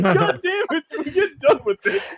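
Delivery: high, laughing complaint; retold teen exasperation; laughing mock frustration. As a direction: groan it through laughter. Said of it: "I'm like".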